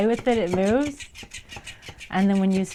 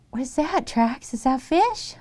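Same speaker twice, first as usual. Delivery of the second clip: excited pet talk; 8 semitones higher, 7 dB louder, slower than usual. Real, not acted. Owner squealing as her cat hisses at the toy fish. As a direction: squeal at the animals with delight.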